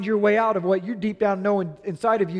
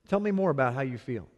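soft, gentle invitation to keep talking; quiet, caring husband voice; calm listening; 7 semitones lower, 6 dB quieter than usual. Shown as a demonstration. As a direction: coax her feelings out patiently. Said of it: "Men, deal with the feeling".

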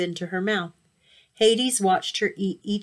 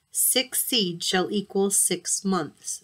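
deliberate decree; slower than usual. Acted. Hermes strikes a deal.

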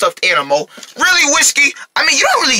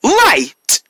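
put-on drawn-out call for kids to repeat; kindergarten teacher voice; 5 semitones higher than usual. Shown as a demonstration. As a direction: call it out for the class. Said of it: "repeat after me".

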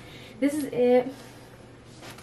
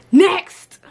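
loud, drawn-out "next"; high, loud call for the next one; 6 semitones higher, 15 dB louder than usual. Real, not acted. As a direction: holler it to move things along. Calls out for next outfit.

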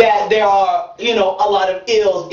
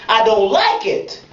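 usual speaking voice

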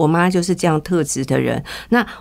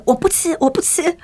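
higher-pitched mock tantrum, retold; imitating mother's tantrum; 9 semitones higher than usual. Daughter imitates her furious mother refusing food, mock tantrum. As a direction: whine I won't eat, imitating her mother. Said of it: "然后就抓狂暴怒".

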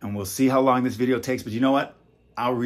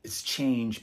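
usual speaking voice

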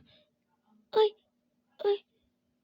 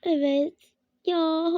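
ordinary delivery

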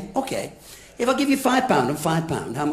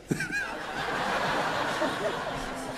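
high soft giggle; 12 semitones higher, 11 dB quieter than usual. Real, not acted. Breaks up laughing.

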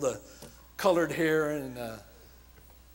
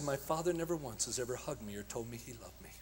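whispered; 7 semitones lower, 11 dB quieter than usual. Retold or imitated. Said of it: "he whispered in my ear".

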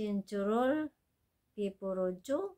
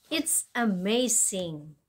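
slow model phrase; 4 dB louder, much slower than usual. Shown as a demonstration. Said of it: "ito pa".

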